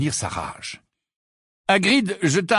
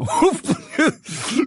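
Hagrid's snort of laughter; 13 semitones higher than usual. A narrator's reading. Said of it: "pouffer de rire".

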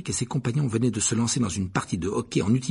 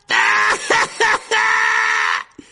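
high, loud braying guffaw; hammed-up roaring laughter; resounding laugh; 9 dB louder than usual. A narrator's reading. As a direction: burst into a huge drawn-out laugh. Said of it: "Il éclata d'un rire sonore".

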